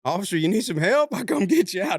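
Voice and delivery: quoting him while cracking up; laughing. Retold or imitated. Laughing while telling friends the suspect offered help. Driver voiced mid-laughter.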